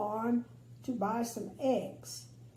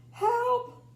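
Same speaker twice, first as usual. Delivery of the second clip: much higher, louder cry for help; shriek for help; 12 semitones higher, 7 dB louder than usual. A narrator's reading. Tiny Miss Tiny shrieks at the cat for help. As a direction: shriek it at the top of her voice. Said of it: "shrieked little Miss Tiny at the top".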